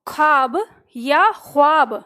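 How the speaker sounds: ख्वाब first plain kh, then throaty Urdu kh, slowly; ख्वाब then ख़्वाब with a throaty rasp; two pronunciations. Shown as a demonstration. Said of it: "पहला या दूसरा". (ख्वाब और ख़्वाब का अंतर)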